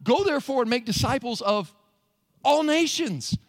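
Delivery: "all nations" punched high and loud after a pause; emphatic correction landing hard on "all nations"; triumphant stress. Shown as a demonstration. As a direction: hold back, then proclaim "all nations".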